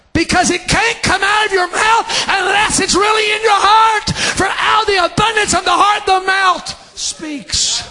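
high, sustained preacher's shout; full-force preaching. Real, not acted. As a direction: shout it out high, without letting up.

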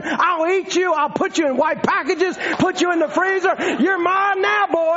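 high-pitched mock scream; hammed-up yelling at an animal; retold screaming threat. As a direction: holler threats as in a retold chase. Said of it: "I'm screaming at him".

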